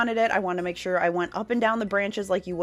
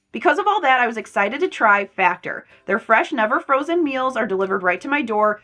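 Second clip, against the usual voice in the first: louder upbeat ad read; 3 semitones higher, 7 dB louder than usual. Acted. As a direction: sell it like a sponsor spot. Sponsored ad read.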